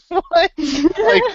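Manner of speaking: loud, high incredulous yelp; baffled. Retold or imitated. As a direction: act out total disbelief. Mock reaction to silly quest giver.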